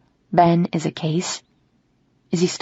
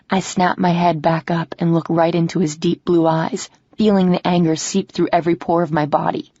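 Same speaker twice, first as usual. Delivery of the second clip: ordinary delivery, nothing marked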